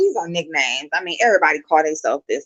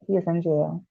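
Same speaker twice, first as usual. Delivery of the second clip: "He is in jail" whispered, softer, as a secret; whispered aside; 6 dB quieter than usual. Real, not acted. Vlogger confides to viewers about her ex, in a whisper.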